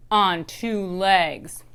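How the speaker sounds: slow, loud mock yell; yelling mom. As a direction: drag it out as a scolding yell. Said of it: "my mom yells".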